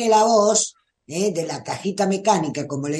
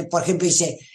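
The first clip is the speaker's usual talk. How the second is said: normal talking voice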